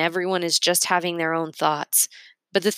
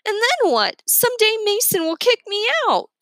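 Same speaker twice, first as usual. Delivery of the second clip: high petulant whine; whiny complaint. A narrator's reading. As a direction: complain like a hurt woman.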